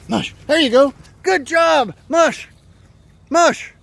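high, loud calling; urging shout. Real, not acted. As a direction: shout the dog on. Urging sled dog to pull.

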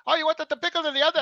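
high, loud, fast put-on worker voice; mock drive-through worker. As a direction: rattle it off high like a confused cashier.

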